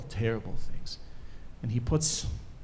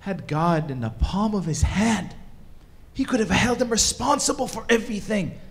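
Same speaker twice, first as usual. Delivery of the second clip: rising to a high, loud protest; impassioned exclamation; 7 semitones higher, 4 dB louder than usual. Acted. Rabbi explaining to baffled students, impassioned and climbing in pitch. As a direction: build in anguish and disbelief to the last word.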